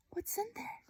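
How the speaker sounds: put-on high, hushed child's whisper; high curious whisper. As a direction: wonder aloud in a breath. Laszlo whispers in story.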